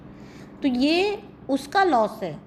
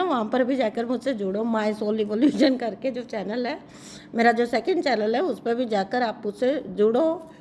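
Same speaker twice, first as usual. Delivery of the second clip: ordinary talk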